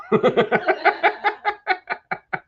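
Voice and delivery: long high-pitched laugh; real amused high laugh. Real, not acted. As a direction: burst into high laughter and let it run. Laughs after reading Danny's comment.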